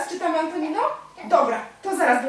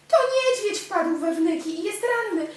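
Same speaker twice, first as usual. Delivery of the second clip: louder, higher storybook alarm; urgent, raised storybook exclamation; dramatic reveal; 6 semitones higher, 4 dB louder than usual. A narrator's reading. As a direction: announce the danger with a gasp of drama.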